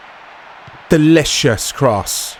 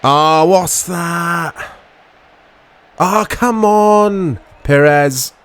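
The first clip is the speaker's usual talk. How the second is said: frustrated groan; 3 semitones higher, much slower than usual. Real, not acted. Frustration at player miss.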